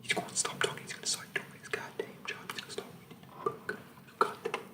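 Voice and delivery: menacing drill sergeant in a soft whisper; creepy whispering sergeant. Acted.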